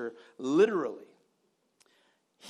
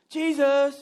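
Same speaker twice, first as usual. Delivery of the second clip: higher, louder urgent call; 7 semitones higher, 8 dB louder than usual. Acted. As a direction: shout his name to get attention. Disciples calling out to Jesus.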